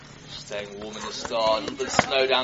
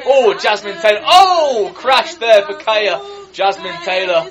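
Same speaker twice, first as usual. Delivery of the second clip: shocked excited shout; 7 semitones higher, 12 dB louder than usual. Real, not acted. Crash in the race.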